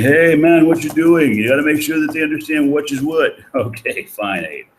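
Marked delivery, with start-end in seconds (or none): 0.0-3.4 louder, higher mock colleague scolding; imitating Professor Hodge. Teacher imitates a colleague nagging about parentheses, louder and higher. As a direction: playfully voice a fellow professor. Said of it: "Professor Hodge saying".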